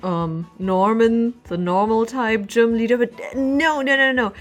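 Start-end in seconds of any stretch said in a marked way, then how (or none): none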